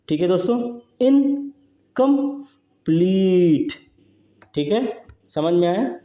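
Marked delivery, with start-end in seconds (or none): 1.0-3.7 incomplete very slowly split, pleat stretched; stressed final syllable. Shown as a demonstration. Teacher showing an anapaest to students, incomplete with long stressed pleat. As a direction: pause after इन and कम, then hold pleat long. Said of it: "ये जो है long level है".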